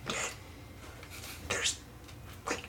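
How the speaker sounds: hushed urgent whisper; whisper yelling. Acted. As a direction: whisper it so the bats won't wake. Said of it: "It's like".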